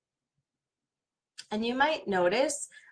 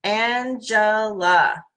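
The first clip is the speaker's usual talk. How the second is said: Angela said very slow; slow sounding of the name Angela; drawn out; much slower than usual. Shown as a demonstration. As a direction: drag out Angela. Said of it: "in all different kinds of voices".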